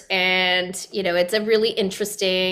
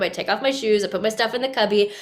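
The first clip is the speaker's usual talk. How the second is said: ordinary talk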